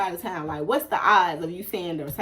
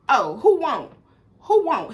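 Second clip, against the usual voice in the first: loud and high, squaring up; challenging call-out; 8 semitones higher, 10 dB louder than usual. Retold or imitated. She turns around to the man in the store, loud challenge. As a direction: throw the challenge back in his face.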